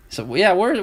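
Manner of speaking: usual speaking voice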